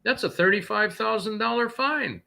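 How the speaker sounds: high-pitched mock cop voice; smug highway cop. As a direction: play the petty cop catching a trucker. Cop's voice in retold story.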